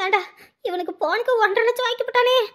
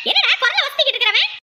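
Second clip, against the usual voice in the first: ordinary talk, nothing marked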